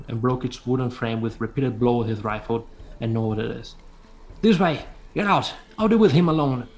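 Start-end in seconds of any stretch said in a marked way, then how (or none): 4.4-6.7 shouted urgent orders, higher. Acted. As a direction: bark it out to get them moving. Native orders kids to flee.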